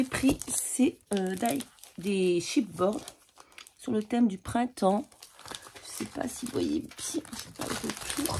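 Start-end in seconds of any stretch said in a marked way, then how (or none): 5.8-8.4 annoyed half-whispered mutter. Real, not acted. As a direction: grumble it under your breath. Muttering at the glare on camera. (marmonné)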